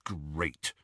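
soft sour irritation; irritable. A narrator's reading. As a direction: grumble it irritably. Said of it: "said Ron irritably".

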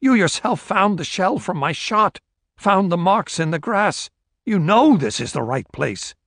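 high, insistent argument, dropping at the end. A narrator's reading. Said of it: "Esterhazy raised his voice".

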